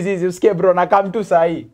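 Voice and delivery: normal talking voice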